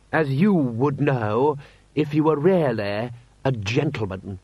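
slow, condescending lordly put-down; haughty snub. Acted. Lord Rust puts the commander in his place, haughty. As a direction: stretch it out to belittle him.

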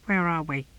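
high little creature voice; tortoise voice. A narrator's reading. Tortoise asking the novice where they are, high-voiced. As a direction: pipe up with a high, small question. Tortoise's voice after head shot out.

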